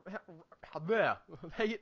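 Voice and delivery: ordinary talk, nothing marked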